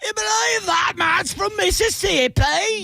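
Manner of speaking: very high, wobbly mock-British voice; hammed-up British accent. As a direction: squeal it in a bad British-Australian accent. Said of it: "and they're like".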